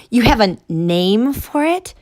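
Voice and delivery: put-on a kid's eager voice; boy voice. Narrator voices kid Noah.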